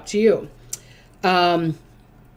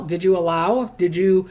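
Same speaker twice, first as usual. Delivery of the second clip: usual speaking voice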